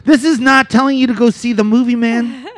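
high, worked-up comic protest; exasperated warning. Real, not acted. Warning listeners off the cautionary tale, high and exasperated.

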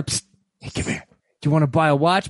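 sly hushed street hustler; psst for attention. Retold or imitated. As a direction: psst it like a shady salesman. Lefty the salesman voiced.

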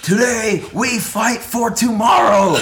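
slow, high, booming battle cry; rally the troops before the fight; roaring rallying speech. Retold or imitated. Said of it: "And he's like".

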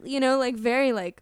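normal talking voice

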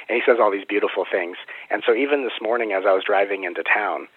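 ordinary talk

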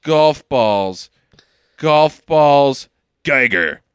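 slow, low, deliberate musing; slow realisation. Retold or imitated. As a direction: piece it together aloud, word by word. Acting out the hero's slow realisation.

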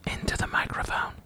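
hushed whisper at the mic. Real, not acted. Dad whispering mic advice to his reading daughter. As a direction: whisper the instruction close to her ear.

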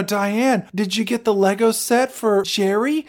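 a put-on mom checking on the gift; hint of a fussy mother. Mother voiced in a story.

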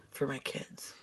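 whispered aside; whispering. Real, not acted. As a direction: drop to a whisper. Unvoiced whisper.

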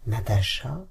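ordinary delivery, nothing marked

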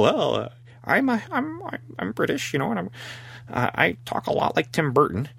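high, stammering British actor voice; Roddy McDowell. Acted. British actor answering the accusers, stammering high impression. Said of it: "and Roddy McDowell".

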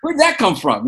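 high, surprised imitation of kids. His kids hearing the accent slip out, mock surprise. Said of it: "my kids every now and again, they were like".

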